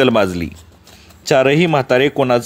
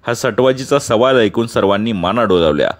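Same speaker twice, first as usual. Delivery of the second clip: ordinary delivery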